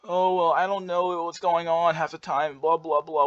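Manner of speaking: high, drawn-out, dithering voice; airy, stretched-out cluelessness; clueless rambler. Shown as a demonstration. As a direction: ramble slowly up high like you have no idea. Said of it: "if you talk in a very".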